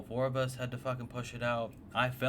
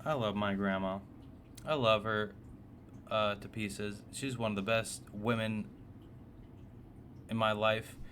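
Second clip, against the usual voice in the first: ordinary talk, nothing marked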